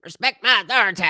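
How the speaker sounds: high, loud character impression; Cartman. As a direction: bellow it as a cartoon impression. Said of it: "Cartman would be better".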